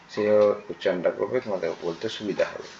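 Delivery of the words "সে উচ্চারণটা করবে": ordinary delivery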